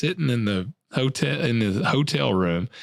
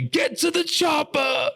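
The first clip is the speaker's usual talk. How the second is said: much higher, slow Arnold shout; Arnold impression. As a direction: yell it like Arnold fleeing the jungle. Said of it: "I was gonna say".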